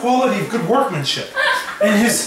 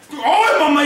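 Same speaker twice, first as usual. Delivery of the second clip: higher-pitched hammed-up pirate cry; pirate style; 7 semitones higher than usual. Victor calling out in battle, almost pirate style. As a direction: call out like a pirate. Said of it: "almost like pirate style".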